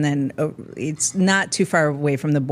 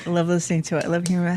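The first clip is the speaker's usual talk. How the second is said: ordinary talk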